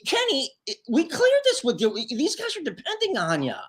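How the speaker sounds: mock-dismayed objection, voice pitched right up; incredulous protest. Bandleader to drummer backing out last minute, incredulous.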